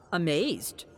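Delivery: ordinary delivery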